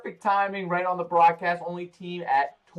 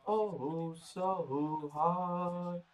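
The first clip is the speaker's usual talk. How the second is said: soft echo; 7 dB quieter, much slower than usual. Acted. Whispered echo.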